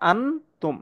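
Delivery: আন তুম with a clear tongue-tip n; clear n, incorrect form. Shown as a demonstration. Teacher shows the form to avoid, clear unhidden n. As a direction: sound a plain n before তুম. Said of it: "তাই এখানে আনতুম হবে না".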